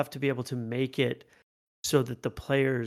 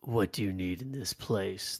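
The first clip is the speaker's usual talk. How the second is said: low, quiet, harsh creature voice; harsh gravelly voice; 6 semitones lower, 8 dB quieter than usual. Acted. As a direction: growl the question from the dark. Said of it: "the harsh, gravelly voice asks".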